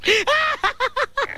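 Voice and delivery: high giggling burst at the absurdity; helpless laugh. Acted. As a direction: crack up at how ridiculous it is. Owner laughs at dog's nonsense.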